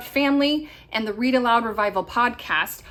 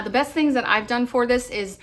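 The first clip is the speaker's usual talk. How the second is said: ordinary talk, nothing marked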